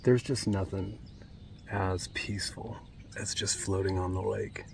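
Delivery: hushed, near-silent whisper; soft whisper on a still lake. Real, not acted. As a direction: whisper so as not to break the calm. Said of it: "I don't know why I'm whispering".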